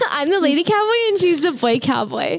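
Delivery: giggling through the words; laughing. Real, not acted. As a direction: laugh while saying it. Laughing at cowboy joke.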